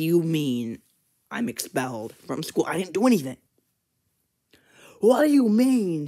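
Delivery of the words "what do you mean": high, loud, slow indignant cry; a loud, high outraged protest; shocked indignant shout. Acted. Upset at being expelled.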